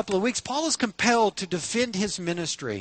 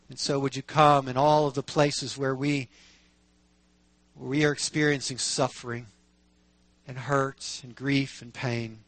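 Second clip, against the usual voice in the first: pastoral prayer; 6 semitones lower than usual. Real, not acted. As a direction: lead the congregation in prayer, pausing between phrases. Leads the congregation in prayer.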